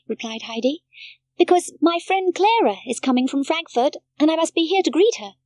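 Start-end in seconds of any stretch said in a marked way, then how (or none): 1.4-5.3 put-on higher, louder little girl; a child politely refusing a friend; Heidi's voice. A narrator's reading. Heidi turns down Peter, high childlike voice.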